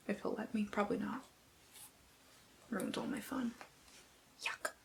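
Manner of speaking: usual speaking voice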